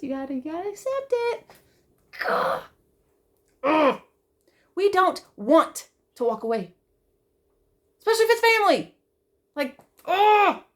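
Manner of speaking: crying through the words, real; holding back tears. Real, not acted. A sister talking about family, tearful and breaking.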